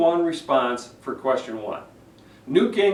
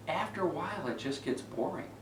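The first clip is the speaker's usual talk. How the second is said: much softer, dropping down; soft and slow; 13 dB quieter than usual. Shown as a demonstration. Coach showing how to slow down, soft. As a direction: pull right back quietly. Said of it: "slow the movie down".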